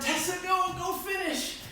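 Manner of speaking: high, soft, begging voice of the exhausted friend; collapsed friend urging Tessa to go on without her; pleading friend. Retold or imitated. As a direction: urge her on gently from the ground, high and quiet. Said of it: "And she implored".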